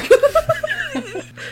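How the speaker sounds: high burst of laughter; incredulous laugh. Real, not acted. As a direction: laugh out in disbelief. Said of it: "I don't believe you anymore".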